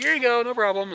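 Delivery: high sing-song mock politeness; lightly voiced cheerful host; imitating obliging host. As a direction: toss it off all sweetness. Owner quoted relenting kindly.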